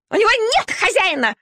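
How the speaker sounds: high, piercing shriek with NEVER-like weight on нет; piercing squeal. A narrator's reading. Shaiba shrieking to the dogs about the puppy, piercing. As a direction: screech it like a spiteful lapdog. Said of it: "пронзительно взвизгнула Шайба".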